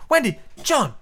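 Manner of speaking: put-on high, urgent children's cries; alarmed cry. A narrator's reading. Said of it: "the three cried simultaneously".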